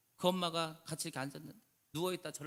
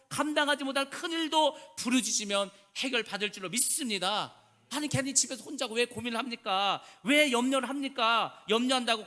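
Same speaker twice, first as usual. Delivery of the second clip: louder, higher fervent sermon voice; full-force preaching; 4 semitones higher, 7 dB louder than usual. Acted. Pastor urging the congregation to cry out in prayer, fervent preaching. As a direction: preach it out to the whole church, ringing.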